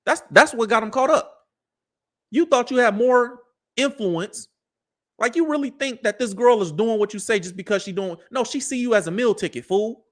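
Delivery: usual speaking voice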